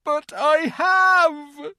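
loud, high, very slow cry; hammed-up drawn-out bawl; anguished wail. A narrator's reading. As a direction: howl the admission. Faun wails his confession.

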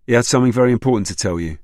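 fast, urgent and pressing. A narrator's reading. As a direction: hurry it out urgently. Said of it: "Robbie asked urgently".

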